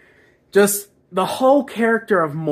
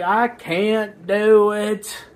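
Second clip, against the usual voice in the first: slow, stretched-out mock whine; mock crying; 3 semitones higher, much slower than usual. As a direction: whimper it like an exaggerated crybaby. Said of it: "he's crying like a little bitch".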